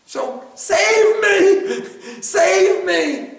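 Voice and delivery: high, drawn-out mock plea; playful cry for rescue. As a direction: cry out to be saved, hammed-up with a laugh. Acts out cry to Mother.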